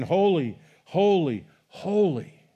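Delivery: a loud heavenly chant of holy; calling out. Acted. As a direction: call it across the throne room like an angel. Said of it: "they were calling one to another".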